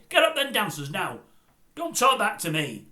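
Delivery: loud, barked order to bed; a father shouting kids upstairs; mock angry dad yelling up the stairs; stern dad. Voicing his stern dad.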